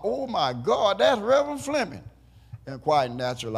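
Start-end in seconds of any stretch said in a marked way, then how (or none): 0.0-2.0 excited holler. Retold or imitated. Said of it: "the lady hollered".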